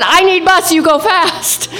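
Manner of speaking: high, laughing broken words; high laughing plea. Retold or imitated. Tourist repeating her plea to the driver, laughing. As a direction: beg again through laughter. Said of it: "And I said".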